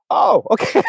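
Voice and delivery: high, laughing "oh, okay" taken aback; mock surprise. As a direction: pretend to be caught off guard, half laughing. Mock reaction to harsh critique.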